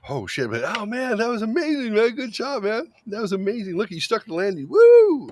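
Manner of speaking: very high, squealing mock praise ending in a whoop; over-the-top cheering. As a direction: cheer a kid's spill like a stunt win. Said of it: "A kid would take a spill and you're like".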